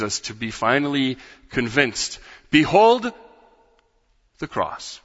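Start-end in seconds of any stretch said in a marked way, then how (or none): 2.5-5.0 high, loud call of Behold; ringing proclamation. Real, not acted. Preacher calling congregation to behold the cross, ringing high. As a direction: cry out Behold to lift their eyes.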